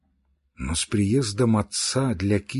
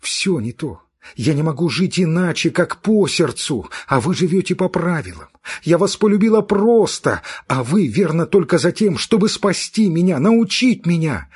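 angry reproach; 6 semitones higher, 6 dB louder than usual. Acted. Kitty's temper continues.

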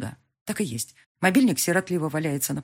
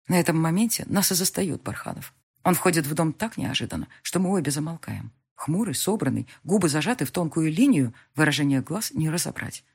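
ordinary delivery, nothing marked

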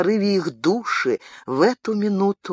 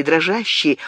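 usual speaking voice

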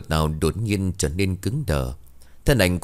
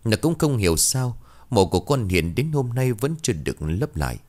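ordinary delivery

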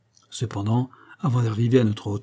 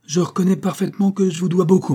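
higher and louder, barely held anger; angry colonel; 8 semitones higher, 4 dB louder than usual. A narrator's reading. Colonel, red with anger, confronts Holmes. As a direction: bristle with restrained fury. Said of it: "devint tout rouge de colère".

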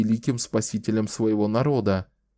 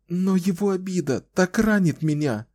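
high, anguished exclamation; exclaiming; 9 semitones higher than usual. A narrator's reading. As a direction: cry it out in hurt. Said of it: "воскликнула Кэлен".

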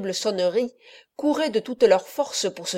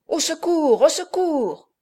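high, louder desperate cry; cry for help; 7 semitones higher, 3 dB louder, slower than usual. A narrator's reading. Don Abbondio calling from upstairs, high panicked cry. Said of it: "ce premier cri désordonné de Don Abbondio".

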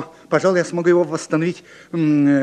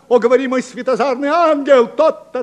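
Romeo declaiming; 7 semitones higher, 3 dB louder than usual. Acted. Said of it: "я пропускаю свои реплики".

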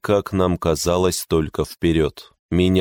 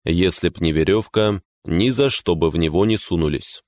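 usual speaking voice

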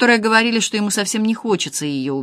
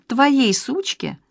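outraged; 6 semitones higher, much slower than usual. A narrator's reading. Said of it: "вскричала Вика".

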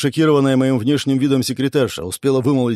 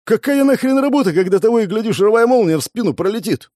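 high-pitched, exasperated outburst; heated protest; 9 semitones higher, 3 dB louder than usual. A narrator's reading. Man protesting he could be struck anytime, heated. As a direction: burst out, voice shooting up. Angry protest to boss about danger.

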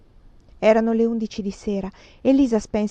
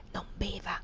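barely audible whisper; mouthed warning; 12 dB quieter than usual. A narrator's reading. Maid secretly warns her mistress, mouthed whisper. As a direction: whisper it almost without sound. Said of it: "mosse le labbra".

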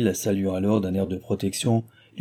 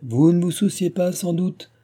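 haughty, higher, louder jab; proud retort; 9 semitones higher, 4 dB louder than usual. A narrator's reading. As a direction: look down on her as you say it. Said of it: "reprit-il fièrement".

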